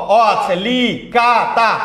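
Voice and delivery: কা and তা as long, separate beats after a drawn লি; syllable by syllable. Shown as a demonstration. Teacher counts four syllables aloud, syllable by syllable. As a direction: stretch লি, কা, তা one at a time. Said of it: "কলিকাতায় কয়টা?".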